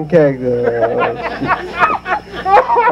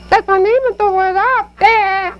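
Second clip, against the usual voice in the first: very high squeaky dummy voice; high puppet voice; Pee Wee dummy voice. Acted. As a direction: pitch way up for the puppet. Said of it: "here's my ventriloquist act".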